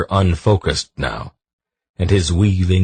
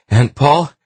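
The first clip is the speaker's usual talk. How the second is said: high, loud urgent shout; 10 semitones higher, 6 dB louder than usual. A narrator's reading. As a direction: call her from across the room, alarmed. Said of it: "he shouted".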